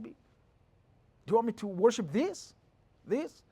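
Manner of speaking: high, slow mock disbelief; incredulous question. Shown as a demonstration. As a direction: scoff as if it is absurd. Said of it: "he said that as a question".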